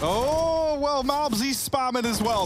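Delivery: high, surprised cry; surprised exclamation. Real, not acted. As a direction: react like you can't believe it. Surprised reaction call.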